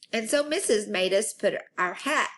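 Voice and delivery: ordinary delivery, nothing marked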